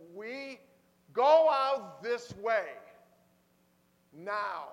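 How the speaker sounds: loud, slow, forceful order; urgent command. Pretend. Preacher ordering people out of a burning place, loud command. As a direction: bark it as an order, every word weighted. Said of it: "That's an imperative".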